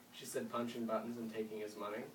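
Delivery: plain reading voice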